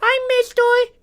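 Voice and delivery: put-on very high-pitched little kid voice; squeaky mock child greeting; twelve-year-old Kyle. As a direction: pipe up like an innocent kid. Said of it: "Twelve-year-old Kyle".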